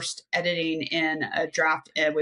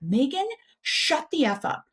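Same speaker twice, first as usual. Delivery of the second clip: mock yell; 7 semitones higher than usual. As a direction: snap at yourself, pitched up. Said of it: "my grit voice just yelled at me".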